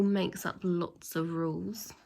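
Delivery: ordinary delivery, nothing marked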